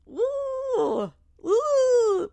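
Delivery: long, high-pitched "woo"; scandalized high woo; high whoop. Real, not acted. Reacting to the child's jab at dad, high whoop.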